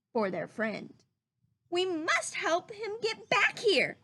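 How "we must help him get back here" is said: a lightly voiced earnest bear, pitched up; mild earnest Pooh; Pooh resolving. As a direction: say it like a little bear who means it. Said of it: "Pooh said".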